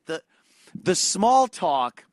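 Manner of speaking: usual speaking voice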